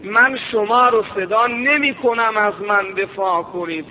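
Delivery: ordinary delivery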